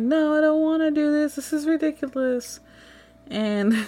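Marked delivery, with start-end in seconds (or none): 0.0-2.6 flustered protest. Retold or imitated. Flustered refusal in retold story.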